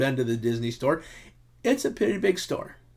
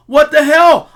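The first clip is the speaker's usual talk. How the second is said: higher, louder outburst; exasperated shout; 11 semitones higher, 11 dB louder than usual. Retold or imitated. Husband quoting his own outburst at his wife, exasperated shout. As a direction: blurt it out, fed up. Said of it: "What you said was".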